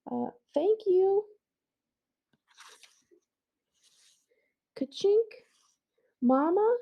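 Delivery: put-on high child's voice, sheepish; girl's voice. Girl at home talks with her mother in a high voice. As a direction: voice her high and a little shy.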